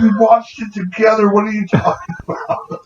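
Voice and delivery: high-pitched, whiny mock protest; exasperated kid. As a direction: imitate a kid at wits' end. Retelling irritated kid's protest.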